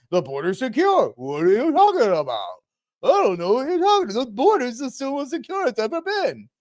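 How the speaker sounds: high, fast, sneering denial; mocking gaslighting. Mimicking officials gaslighting Americans, high, fast and smug. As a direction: parody a smug official brushing it off, quick and high. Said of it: "try to gaslight the American people by saying".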